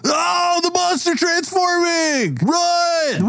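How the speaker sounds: very high drawn-out shriek; hammed-up squealing terror; mock panicked scream. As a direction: scream the alarm to the crowd. Partygoers panic at the monster.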